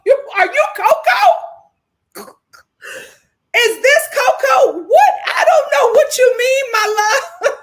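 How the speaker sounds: high and loud, cracking up; yelling through laughter at the comment; laughing shout. Real, not acted. Host reading a confusing comment, laughing loudly.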